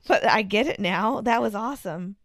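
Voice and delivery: normal talking voice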